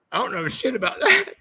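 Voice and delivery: ordinary talk, nothing marked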